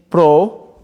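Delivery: normal talking voice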